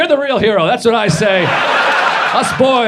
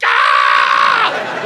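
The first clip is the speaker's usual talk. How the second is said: mock labor scream. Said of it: "I thought every minute was like".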